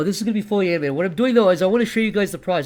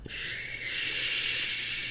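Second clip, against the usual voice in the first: unvoiced shush; soft, breathless shush; hush; 12 dB quieter than usual. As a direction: hush him with a breathy shh. Creepy kid hushing him.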